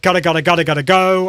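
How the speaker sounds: "gotta, gotta, gotta, gotta go" crisply articulated; tongue twister. Shown as a demonstration. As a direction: snap out each "gotta" clearly. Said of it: "tongue twisters".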